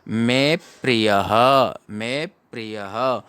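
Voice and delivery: मे held and pressed into the प of प्रियः; stress on मे. Shown as a demonstration. Teacher showing the conjunct's effect, weight on मे. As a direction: lean on मे, then release प्रियः slowly. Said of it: "मे पर बल दिया जाता है".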